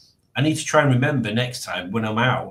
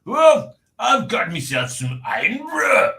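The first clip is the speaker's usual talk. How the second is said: loud, higher mock Scottish shout; hammed Scottish accent; 11 semitones higher, 4 dB louder than usual. Shown as a demonstration. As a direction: belt it out in a put-on Scottish accent. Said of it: "I don't know if that's good or not".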